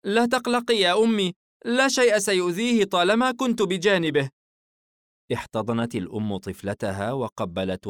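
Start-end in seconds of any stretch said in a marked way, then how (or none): 0.1-4.3 put-on high girl's voice, reassuring; confident little sister, pitched up; soothing young girl. A narrator's reading. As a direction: play a daughter calming her mother. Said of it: "الفتاة مهدئة والدتها".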